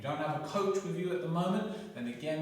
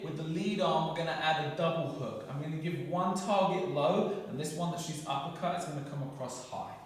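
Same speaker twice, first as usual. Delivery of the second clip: ordinary talk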